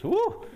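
sharp falsetto whoo of relief; high whoo. Retold or imitated. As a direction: let out a high whoop, as if the heat just hit. Reacting to the hot sauce.

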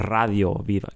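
Radio with a rolled Spanish r. Shown as a demonstration. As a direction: roll the r in Radio. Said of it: "it's like you roll the R".

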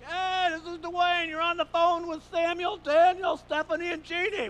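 booming, drawn-out, high hearty hello; big loud sing-out to the whole car; dad's phone greeting. Retold or imitated. Dad announcing the car's passengers to a caller, loud hearty greeting. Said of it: "most of the time he'd be like".

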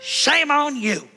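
self-mocking righteous rant; retold angry scolding. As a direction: scold her like an offended man of God. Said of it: "I'm shouting at this lady".

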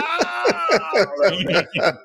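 high wincing "ah"; high-pitched mock yelp; pained mock cry. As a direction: cry out as if wincing, without extra volume. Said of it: "so you're kinda like".

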